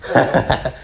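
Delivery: short breathy acted chuckle; mocking breathy snicker; villain's laugh. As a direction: chuckle scornfully in defeat. Freed laughs after being beaten.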